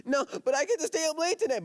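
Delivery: put-on high whiny child protest; whiny kid. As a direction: whine like a kid who wants to stay up. Nephew whining in story.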